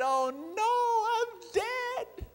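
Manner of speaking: high-pitched cartoon mouse in a panic; panicked mouse. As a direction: squeal it out like a terrified little mouse. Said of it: "And the mouse said".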